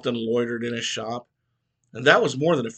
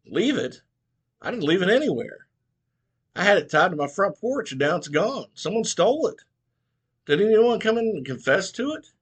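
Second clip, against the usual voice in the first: worked-up Mitchell; 7 semitones higher than usual. Acted. Mitchell worked up, boat stolen.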